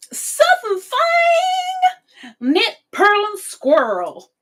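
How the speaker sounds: drawn-out high announcer call on Fang; put-on announcer voice. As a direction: stretch the name out like a showman. Reading viewer names in voice.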